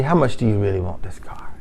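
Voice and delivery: coaxing, hushed and quick; sly salesman whisper. Acted. Car salesman tempting the buyer, fast hushed whisper. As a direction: lean in and tempt the buyer under your breath.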